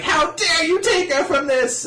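high-pitched indignant cry; hammed-up outrage at a wrongdoer; mock outrage. Retold outraged accusation in manga recap.

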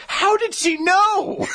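high, drawn-out mock outrage; mock disbelief. Retelling getting caught by the teacher, mock disbelief.

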